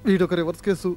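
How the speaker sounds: low muttered aside; quiet grumble to oneself; muttering. Acted. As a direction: grumble it under your breath, soft and low. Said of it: "ఏంటి గొణుకుతున్నావు".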